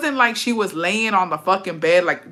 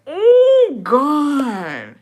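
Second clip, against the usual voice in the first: shocked gasp; 8 semitones higher, 6 dB louder than usual. Real, not acted. Shocked reaction to a story.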